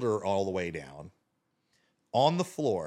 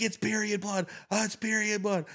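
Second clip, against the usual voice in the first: retold yell; 11 semitones higher than usual. Storyteller mimics sister repeating the reveal, high mock yell. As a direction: imitate her insisting in a high-pitched cry. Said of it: "She's yelling at me".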